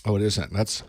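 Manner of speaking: ordinary talk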